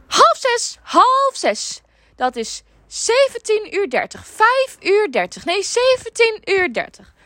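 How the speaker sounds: high, loud, slow nagging customer; old lady voice. Retold or imitated. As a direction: chatter on like a bossy old lady. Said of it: "Bleef ze maar mijn oor tetteren".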